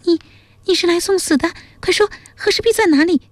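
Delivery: high-pitched startled princess, demanding an answer; shocked high character voice, pressing urgently; alarmed princess. A narrator's reading. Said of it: "江米惊道".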